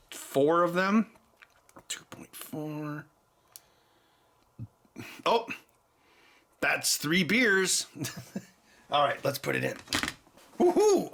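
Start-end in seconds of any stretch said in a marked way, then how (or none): 1.9-3.0 whispered to himself, slow; hushed slow counting. Real, not acted. Counting beers under his breath in a slow whisper. 10.6-11.1 high excited whoop; a real gleeful whoop; cheer. Real, not acted. Cheering at his beer count in a high whoop.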